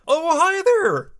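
high, slow hello that cracks; cracking voice. Real, not acted. Retrying Ron's greeting, voice cracking. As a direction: stretch the greeting up until it cracks. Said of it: "Dice cracked again".

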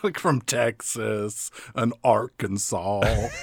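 slow, low Southern drawl; low, drawn-out mock Southern accent; mock Alabama accent. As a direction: imitate a slow-talking Alabama boy. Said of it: "It is the accent that delivers the dialogue".

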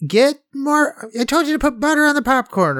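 high-pitched nag at her husband; mock wife, pitched well up; imitated nagging wife. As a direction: scold him in a high wifely voice. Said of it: "she is like".

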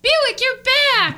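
high, slow delighted cry; excited. Acted. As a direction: greet the car with joy. Stacy greets returning car.

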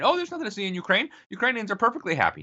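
high, fast sneering mock innocence. Host mocking newspaper lying about Ukraine, high sneering voice. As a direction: mimic a smug liar brushing it off, pitched high.

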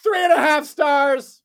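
high, slow announced rating; critic voice. Retold or imitated. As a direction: read the rating grandly.

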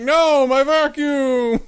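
high, slow, clingy whine; whiny master. Retold or imitated. Master grabbing his vacuum, in a high slow whine. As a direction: drag it out like a dim owner.

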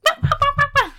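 high, loud imitated yaps; rapid high-pitched mock barks; yappy dog barking. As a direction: mimic a little dog yapping away. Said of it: "the dog's like".

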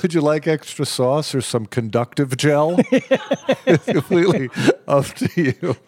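polite waiter, laughing through it. Pretend. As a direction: offer it like a fussy server. Playing mock waiter offering sauce.

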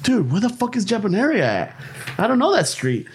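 baffled by an unknown street; retold bewilderment; confused. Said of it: "I'm like".

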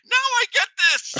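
a naive guy finally getting it; realizing laugh. Retold or imitated. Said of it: "He's like".

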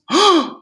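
sharp, very high shocked gasp; imitated gasp. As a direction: mimic the audience's shocked gasp. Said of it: "half the audience goes".

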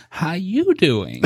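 very slow, drawn-out menacing hello; creepy killer greeting. Retold or imitated. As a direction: stretch each word out like a slasher toying with prey. Playing killer greeting scared couple.

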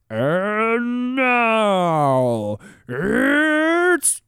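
slow, stretched, high ring announcer. Retold or imitated. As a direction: drag out every word like the UFC intro. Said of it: "kinda revs up that last word".

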